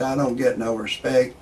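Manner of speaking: usual speaking voice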